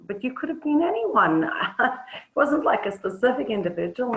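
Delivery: half-laughing disbelief; incredulous protest. Acted.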